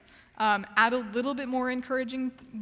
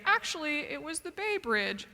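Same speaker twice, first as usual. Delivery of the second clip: lightly voiced know-it-all, higher pitch; pedantic corrector; 5 semitones higher than usual. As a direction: cut in as a nitpicker, slightly mocking. Acts out an interrupting pedantic corrector.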